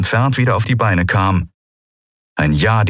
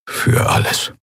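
voiceless, parting thanks; hushed farewell. Acted. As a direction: finish the goodbye almost whispering. Keru's hushed farewell before leaving. (leise, fast tonlos zum Abschied)

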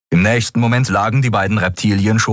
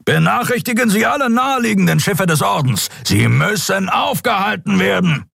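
high, far, forceful shout; captain roaring at his bridge crew; 13 semitones higher than usual. Acted. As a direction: bark the report like a furious hunter. Captain orders pursuit.

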